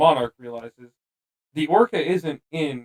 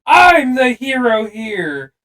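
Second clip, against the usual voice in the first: loud, slow, high villain rant; mustache twirling villain; 7 semitones higher, 9 dB louder, much slower than usual. Retold or imitated. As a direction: ham up a movie villain's speech. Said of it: "mustache twirling villain speech".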